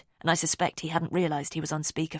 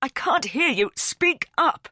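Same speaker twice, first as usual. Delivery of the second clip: much higher, louder call over a bad line; raising his voice over static; raised voice; 10 semitones higher, 6 dB louder, slower than usual. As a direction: shout to be heard. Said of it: "he says, raising his voice".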